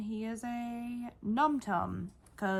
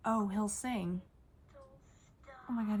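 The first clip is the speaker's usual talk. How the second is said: normal talking voice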